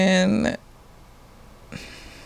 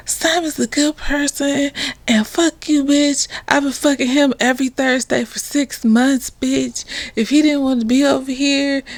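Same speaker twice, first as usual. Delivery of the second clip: high, heated mock tirade; retold rant, pitched high and indignant; going off; 6 semitones higher than usual. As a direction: tear into her like a jealous girlfriend. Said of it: "Alyssa goes the fuck off".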